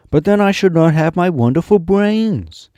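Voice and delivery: loud, high exclamation; crying out. Acted. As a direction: call it out as the Scarecrow. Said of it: "cried the Scarecrow".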